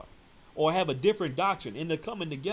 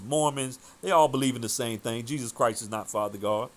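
ordinary talk, nothing marked

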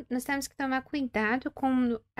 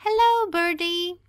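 higher, louder call to a bird; yelling; 8 semitones higher, 9 dB louder, slower than usual. Acted. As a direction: shout hello to the birdie, slowly. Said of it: "começa a gritar".

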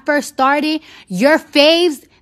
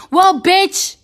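higher and louder, hammering the retort; angry comeback; 4 semitones higher, 4 dB louder than usual. Real, not acted. Talking back to the critics, loud and angry. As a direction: bite back hard at the haters.

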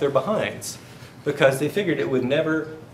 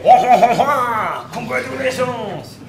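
loud, high mock French laugh and cheer; hammed-up French party guest, booming and high; French accent; 8 semitones higher, 6 dB louder, slower than usual. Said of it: "That's my French accent".

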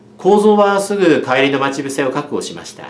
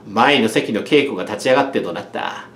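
ordinary delivery

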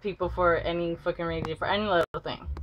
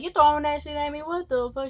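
angry confrontation; 8 semitones higher than usual. Retold or imitated. Girl confronts Demonte.

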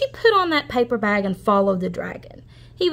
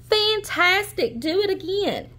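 high, slow, drawn-out praise; shouted praise; 10 semitones higher than usual. Acted. Princess cheering the tired dragon on, high and slow. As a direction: cheer him on to go again. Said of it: "but Elizabeth shouted".

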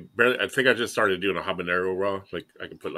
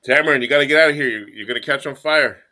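calling to the dog; 6 semitones higher, 7 dB louder than usual. Real, not acted. Shooing the dog away.

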